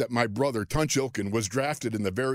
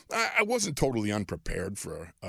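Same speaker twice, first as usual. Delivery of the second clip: ordinary talk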